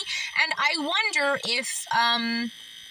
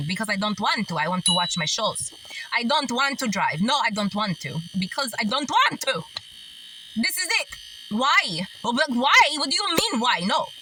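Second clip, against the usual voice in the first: a lightly done stubborn Greek grandma saying no; Greek grandmother accent. Retold or imitated. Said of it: "This is her right here".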